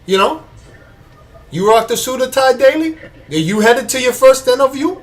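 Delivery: high, hammed-up Cuban gangster accent; Tony Montana accent. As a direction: hype up the listener like a Cuban gangster, in a mock Cuban accent. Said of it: "Dave suggested Tony Montana".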